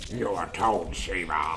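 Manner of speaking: lightly voiced gravelly warrior; a hint of a growling Baraka; Baraka impression. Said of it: "try to do a Baraka impression".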